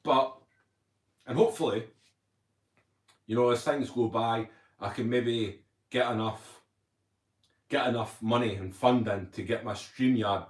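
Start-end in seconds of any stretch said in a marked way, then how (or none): none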